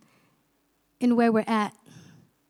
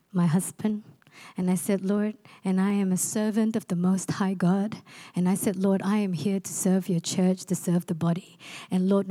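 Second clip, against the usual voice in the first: hushed prayer; 6 semitones lower than usual. Retold or imitated. Preacher retelling her prayer of submission, hushed and lower.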